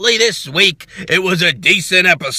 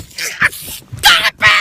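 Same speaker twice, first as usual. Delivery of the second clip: high, strained yell; mock shrieked plea; 9 semitones higher than usual. Vlogger reacting to Roman's face, mock high yell. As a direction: squeal it like you can't take it anymore. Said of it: "and I'm like".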